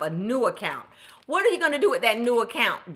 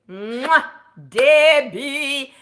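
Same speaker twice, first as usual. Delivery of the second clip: higher, louder, drawn-out name; excited praise; 5 semitones higher, 6 dB louder, much slower than usual. Real, not acted. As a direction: celebrate her with a kiss. Blowing kiss, praising viewer.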